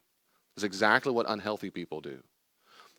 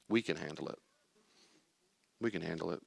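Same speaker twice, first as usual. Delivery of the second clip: low, soft reassurance; calm self-talk; 3 semitones lower, 8 dB quieter, faster than usual. Shown as a demonstration. Speaker reassuring the wounded child, low and soft. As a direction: soothe quietly, lower and softer. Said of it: "I'm gonna say, I like to say this too".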